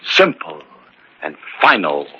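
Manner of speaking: normal talking voice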